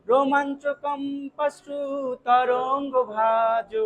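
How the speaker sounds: slow, careful রোমাঞ্চ কম্পাস্ট্রু তরঙ্গ ভাজ with no su; correct recitation. Shown as a demonstration. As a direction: chant each word slowly, joined as কম্পাস্ট্রু. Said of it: "এই জায়গাটাই ভুল করে". (ধীরে সঠিক উচ্চারণে আবৃত্তি)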